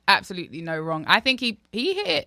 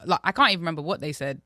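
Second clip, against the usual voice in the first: normal talking voice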